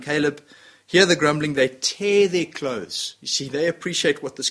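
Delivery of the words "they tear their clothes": slowed, heavy words, tear hit hard; grave slow telling; slow dramatic emphasis. Acted. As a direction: lay out "tear" slowly and deliberately. Dramatic retelling of Joshua.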